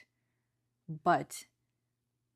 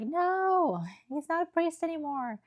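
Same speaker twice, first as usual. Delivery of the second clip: much higher, insistent wife voice; a wife flatly forbidding it, pitched high; indignant wife; 11 semitones higher than usual. Retold or imitated. Wife refuses, voiced in retelling.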